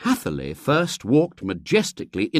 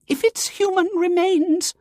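high, shaky frightened lady; quavering fright; 4 dB louder than usual. A narrator's reading. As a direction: quaver in nervous dread. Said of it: "quavered Miss Simke".

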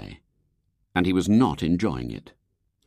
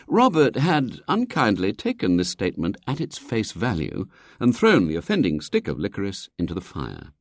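ordinary delivery

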